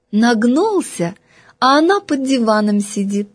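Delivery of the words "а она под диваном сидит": plain reading voice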